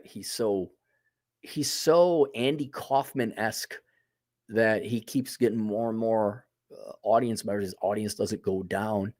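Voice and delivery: ordinary talk, nothing marked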